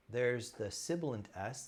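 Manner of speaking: ordinary talk, nothing marked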